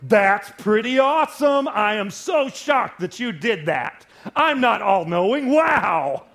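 hammed-up God marveling slowly; mock amazed God. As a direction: lampoon a God caught by surprise. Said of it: "God doesn't go".

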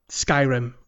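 ordinary talk

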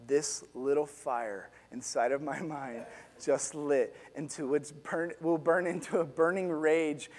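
low, soft voice-over, lightly done; a hint of a film narrator's inner monologue. Ralphie's mind voice as rage lights, lightly voiced narrator. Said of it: "the inside mind voice of".

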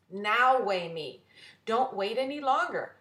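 insistent demand. Acted. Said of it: "said Mary".

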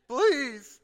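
loud, high mock begging; one hammed-up burst of pleading; desperate plea. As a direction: beg like an overwhelmed parent. Retelling desperation as new parent.

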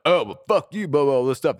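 loud mock dismissive guy; imitating rude guys. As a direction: brush off with a snarl, lightly voiced. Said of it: "And they're like".

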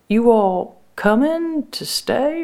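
high, slow, hesitant asking; wary question. Acted. Sister asking unexpected family about their plans, wary.

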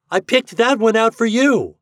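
very high, loud taunt; teasing cry. A narrator's reading. Reddy teases Herbie about the apple, crying out. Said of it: "cried Reddy".

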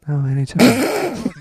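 high, slow, teasing coo; boyfriend voice. Pretend. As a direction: drawl it out sweetly to melt her. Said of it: "you can break me down by using it".

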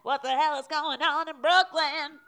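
big hyped call-out, high and loud; loud announcer voice. Pretend. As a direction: belt it like a show intro. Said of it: "That's good".

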